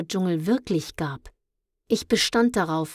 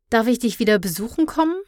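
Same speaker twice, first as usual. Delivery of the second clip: quick, higher, louder amused question; 5 semitones higher, 6 dB louder, much faster than usual. A narrator's reading. Luna teases Mondstärker as she leaves, amused. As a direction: ask playfully, not believing it will work. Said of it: "fragte ich belustigt".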